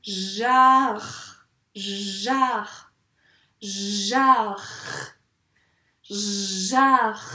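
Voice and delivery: "Jar" slow and loud, swelling on the a; emphatic drawn-out "Jar" ending on a long French r; three sounds stretched. Shown as a demonstration. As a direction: repeat "Jar" with each of its three sounds held. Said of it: "Attention, il y a trois sons".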